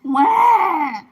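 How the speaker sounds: loud high sobbing wail; crying scream. Real, not acted. Frightened player breaks into a loud crying wail. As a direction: burst into a real crying scream.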